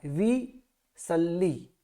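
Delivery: विसल्ली very slow, double ल held; विसल्ली drawn out syllable by syllable; slow careful विसल्ली; correct way. Shown as a demonstration. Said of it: "विस नहीं बोलना है".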